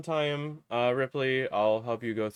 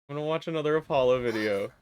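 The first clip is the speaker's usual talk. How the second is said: normal talking voice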